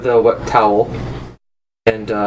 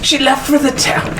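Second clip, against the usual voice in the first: breathy vintage purr; 9 semitones higher than usual. Acted. GM continues Lady Jezebel.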